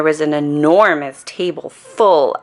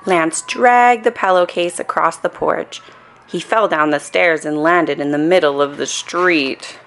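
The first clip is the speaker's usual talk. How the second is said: ordinary delivery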